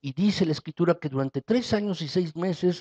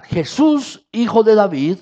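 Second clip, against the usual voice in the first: louder, higher desperate cry; shouting for mercy; 4 semitones higher, 9 dB louder than usual. Acted. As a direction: yell it out to Jesus, begging. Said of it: "Y volvió a gritar".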